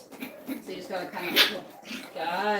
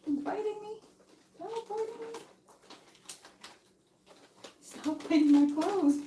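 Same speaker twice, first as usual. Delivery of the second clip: mild puppy scolding; 13 semitones higher than usual. Real, not acted. Scolding puppies biting her.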